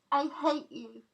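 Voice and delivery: high, loud sobbing cry; tearful outburst. A narrator's reading. Girl crying out at the man who hurt her, sobbing loudly. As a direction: hurl it at him through tears. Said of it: "she sobbed".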